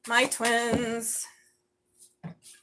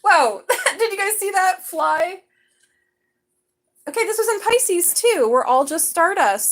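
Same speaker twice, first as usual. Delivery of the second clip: excited; 10 semitones higher, 7 dB louder than usual. Real, not acted. Reacts to a fly.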